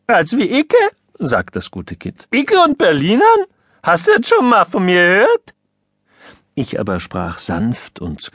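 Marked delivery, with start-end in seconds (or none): 0.1-0.8 put-on high, cracking teenage squeak; a boy whose voice is breaking, indignant. A narrator's reading. Son protesting to his father in a cracking, high squeak. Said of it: "spricht er wie aus einer alten Kasserolle". 2.3-5.5 high, loud, cracking adolescent voice; boy with breaking voice. A narrator's reading. Son denying he ever speaks Berlin dialect, high cracking squeak. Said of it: "spricht er wie aus einer alten Kasserolle".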